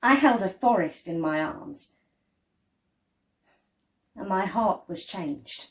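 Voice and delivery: usual speaking voice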